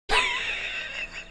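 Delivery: high squealing burst of laughter; squealed laugh at the absurd post; shrieking laugh. Real, not acted.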